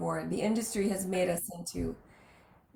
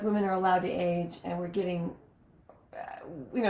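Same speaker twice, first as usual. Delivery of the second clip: ordinary talk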